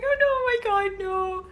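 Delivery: very high, loud mock wail; high-pitched, hammed-up despair; distraught wail. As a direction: wail it like a heartbroken fan. Reliving a character's death.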